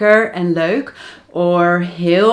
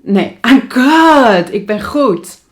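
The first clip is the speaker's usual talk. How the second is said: higher and louder, slow Nee, I'm good; learner's wrong reply; 4 semitones higher, 7 dB louder, slower than usual. Retold or imitated. Teacher mimicking a student declining a drink, raised. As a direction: play the learner's mistaken answer. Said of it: "So not like".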